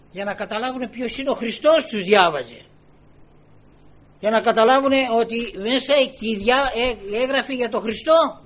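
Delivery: emphatic preaching. Real, not acted. Preacher explains why Christ read Isaiah, emphatic. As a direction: drive the point home forcefully.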